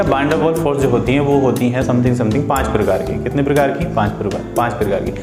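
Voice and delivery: ordinary talk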